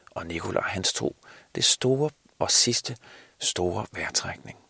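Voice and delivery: ordinary delivery, nothing marked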